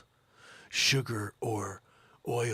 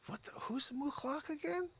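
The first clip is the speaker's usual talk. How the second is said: high whispered puzzled aside; mock muttered confusion; confused whisper; 11 semitones higher, 11 dB quieter than usual. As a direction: whisper it to yourself baffled. Confused inner voice.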